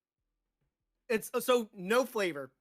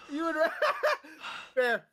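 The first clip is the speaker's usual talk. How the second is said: ordinary delivery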